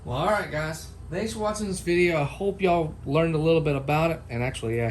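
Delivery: ordinary delivery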